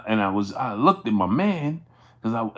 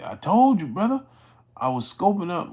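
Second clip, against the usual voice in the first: higher-pitched mock insistence; retold insistence; 6 semitones higher than usual. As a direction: imitate an emphatic reply to an interviewer. Retells friend's insistent interview answer.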